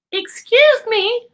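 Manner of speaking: very high, small, slow-spoken cricket voice; a tiny, polite cricket clearing his throat. A narrator's reading. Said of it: "coughed a cricket".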